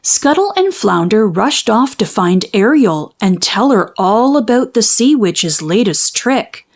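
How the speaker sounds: ordinary delivery, nothing marked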